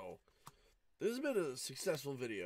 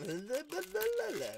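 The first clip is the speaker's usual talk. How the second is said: high giddy laugh; excited laugh; 6 dB louder than usual. Real, not acted. Laughing at the pulled card.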